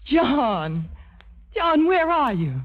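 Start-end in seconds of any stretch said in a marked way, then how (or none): 1.5-2.5 higher and louder, slow and thrilled; suddenly overjoyed to hear him; delighted surprise. Acted. Jane hears John on the phone.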